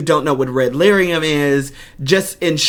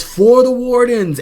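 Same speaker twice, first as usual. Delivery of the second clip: much higher, louder rallying cry; heroic battle cry; 10 semitones higher, 4 dB louder than usual. Retold or imitated. Fan quoting the hero's battle cry from the trailer. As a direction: call it out like a warden rallying troops. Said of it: "we know he says".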